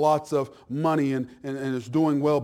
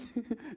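a quick amused chuckle; a brief real laugh breaking in; short laugh; 8 semitones higher, 12 dB quieter than usual. Real, not acted. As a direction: laugh at the joke. Preacher laughs mid-quote.

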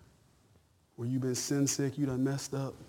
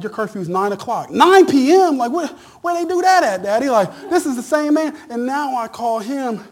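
high, loud preaching build; rising sermon fervor, loud and high; whooping preacher climb; preaching at full force; 6 semitones higher, 11 dB louder than usual. Acted.

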